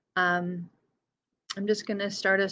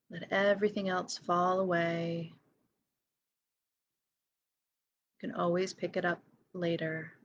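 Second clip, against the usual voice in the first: guided meditation. Real, not acted. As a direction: lead each breath gently, leaving silence between phrases. Leads a breathing meditation.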